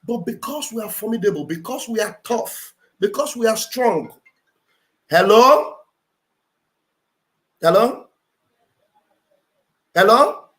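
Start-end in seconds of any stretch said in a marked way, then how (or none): 5.1-10.4 each hello loud and rising, waiting between; loud repeated hellos. Real, not acted. Host calling out again and again to a silent caller, loud rising hellos. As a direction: keep calling out to a dead line.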